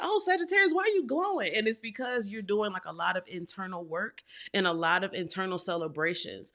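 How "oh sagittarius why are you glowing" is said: much higher, gushing mock-amazed voice; admiring onlookers. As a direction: coo it up high like impressed fans. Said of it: "people are like".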